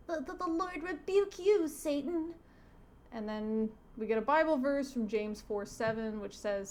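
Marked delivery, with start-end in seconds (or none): 0.1-2.3 put-on high, stammering little boy; little kid voice. Boy rebuking the devil, little kid voice. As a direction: put on a small child's high-pitched voice. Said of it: "little kid voice".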